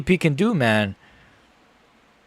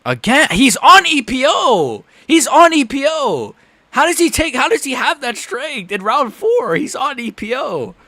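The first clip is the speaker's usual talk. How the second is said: exasperated rant; 10 semitones higher than usual. Real, not acted. Streamer outraged at the opponent's strength, exasperated rant. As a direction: whine it in outraged disbelief.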